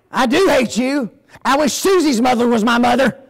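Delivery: put-on a kid doubling down in anger; defiant angry child. Daughter defying her mother, angry and loud.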